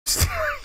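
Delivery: high squealing laugh; high cringing laugh. Real, not acted. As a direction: squeal with embarrassment.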